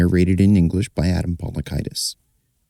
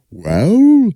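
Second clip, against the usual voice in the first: much higher, louder little dog; a tiny dog's sudden high well; 14 semitones higher, 5 dB louder than usual. Acted. Dog answering the flea, high voice.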